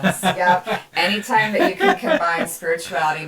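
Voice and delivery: amused laughter over the words; laughing. Real, not acted. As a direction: talk through a giggle. Laughing at the event name.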